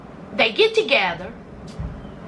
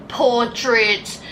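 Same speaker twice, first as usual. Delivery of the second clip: ordinary talk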